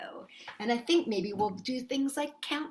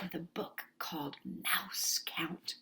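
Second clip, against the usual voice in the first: hushed, secretive whisper; 5 semitones lower, 10 dB quieter than usual. Acted. Teacher hushing children before the book, whispering.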